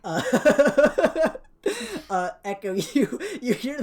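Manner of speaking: laughing while talking; delighted, through laughter. Real, not acted.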